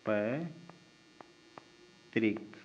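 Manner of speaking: पैतृक drawn out very slowly and softly; slow dictation. Shown as a demonstration. Teacher rewriting पैतृक on board, slow dictation. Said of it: "पैतृक किस तरह से लिखा जाएगा?".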